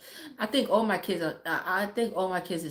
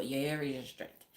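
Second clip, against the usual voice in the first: put-on lower, softer Caribbean-accented saying; 4 semitones lower, 7 dB quieter than usual. Quoting Caribbean family belief about hair, light Caribbean accent. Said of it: "That's Caribbean people".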